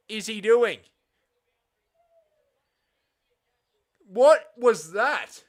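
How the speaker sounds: loud, high, disbelieving outburst; stunned cry at a dropped catch; real shock. Real, not acted. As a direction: yell at the screen in real disbelief. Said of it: "I'm in shock".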